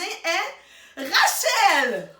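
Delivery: drawn-out, rising build to the name; slow, loud, grand announcement; triumphant reveal. Real, not acted. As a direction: hold back, then belt out the answer.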